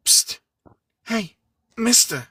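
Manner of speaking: put-on high, sharp young girl calling; girl's voice. A narrator's reading. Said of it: "A girl's voice coming from nowhere, as sharp as glass".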